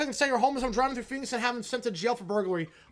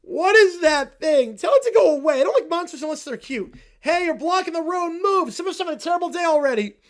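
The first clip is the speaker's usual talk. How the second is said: put-on whiny kid; 6 semitones higher, 8 dB louder than usual. Kids whine at Demogorgon.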